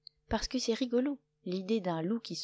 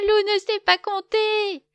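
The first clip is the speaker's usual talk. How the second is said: loud, high laughing taunt; 13 semitones higher, 11 dB louder than usual. A narrator's reading. Lapin mocking Loup through loud laughter. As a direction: cackle the taunt out. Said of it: "se met à rire".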